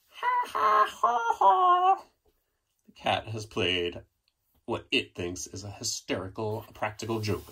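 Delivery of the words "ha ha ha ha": very high mocking ha ha; cackling cat. Cat mocking the dogs with high laughter.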